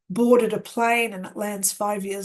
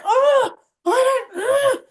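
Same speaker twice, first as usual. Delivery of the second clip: very high, loud exasperated cry; frustrated outburst; 13 semitones higher, 5 dB louder than usual. Retold or imitated. Woman reacting to a lost newcomer, frustrated. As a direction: act out being at a loss, voice shooting up. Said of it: "she's so con-, frustrated".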